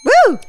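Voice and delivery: high, loud "woo"; short whooped cheer; celebratory woo. Real, not acted. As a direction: let out a high-pitched woo. Delighted cheer at friend's answer.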